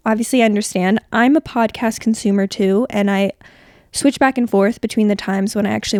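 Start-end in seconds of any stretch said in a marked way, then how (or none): none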